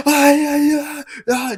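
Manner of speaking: loud, high-pitched whooping cheer; imitated crowd cheering. Cinema crowd erupting at the film, imitated cheering. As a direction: roar like an excited cinema audience.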